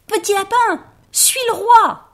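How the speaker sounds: very high, loud triumphant shout; shouting very loudly. Acted. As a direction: yell it so every rabbit hears. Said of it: "cria très, très fort".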